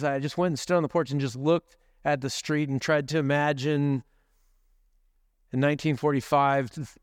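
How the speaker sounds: usual speaking voice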